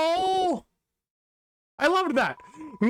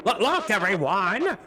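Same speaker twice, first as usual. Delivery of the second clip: bouncy, showy pitch with big swoops; eager TV character. Acted. Reads game character TV's lines.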